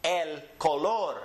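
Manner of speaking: slow El color, el ko-LOR; Spanish pronunciation. Shown as a demonstration. Teacher models for repeating, El color slowly stressed on LOR. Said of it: "Repeat after me".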